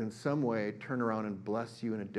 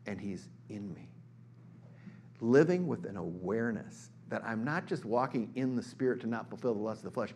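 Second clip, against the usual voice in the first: ordinary talk, nothing marked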